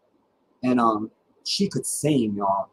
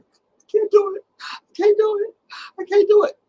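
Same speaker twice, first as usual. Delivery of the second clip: very high hiccup-cry wail; mock crying wail; 5 dB louder than usual. Walking down the church aisle, mock hiccup crying, very high. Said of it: "I was like".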